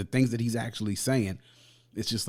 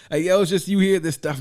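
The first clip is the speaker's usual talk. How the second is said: excited; 7 semitones higher, 5 dB louder than usual. Real, not acted. Excited after the laugh.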